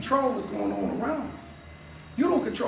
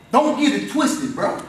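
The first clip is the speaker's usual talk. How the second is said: loud, driving motivational preaching, higher; preaching at full force; 4 semitones higher, 5 dB louder, slower than usual. Acted. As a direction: hammer each line home to the students. Preaching loudly about his school.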